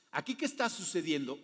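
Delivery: usual speaking voice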